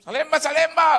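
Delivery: loud, high bus tout's call; shouted destination call. Retold or imitated. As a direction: holler the stop for passengers. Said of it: "Dia teriak-teriak".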